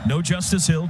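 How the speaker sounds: ordinary talk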